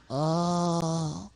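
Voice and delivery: long, held "Oh"; O sound. Acted. Sock repeats the letter for puppeteer, long held "Oh". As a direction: puppet sounding out "Oh" as one drawn-out vowel. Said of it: "One more time".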